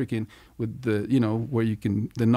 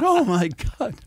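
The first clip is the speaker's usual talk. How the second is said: grandma's horrified cry; 11 semitones higher, 3 dB louder than usual. Retold or imitated. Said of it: "and she starts crying".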